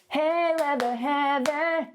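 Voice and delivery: teasing students chanting her nickname; mocking crowd taunt. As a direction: jeer at her from the bleachers. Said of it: "everyone's just going".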